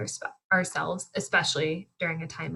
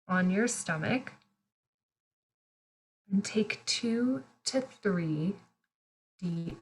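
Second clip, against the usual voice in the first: guided meditation. Real, not acted. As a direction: guide them gently through each breath, unhurried. Leads a breathing meditation.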